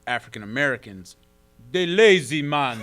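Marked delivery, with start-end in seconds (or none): 1.7-2.8 put-on Jamaican accent. Host imitates his Jamaican friend's opinion, in a Jamaican accent. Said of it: "How was that accent?".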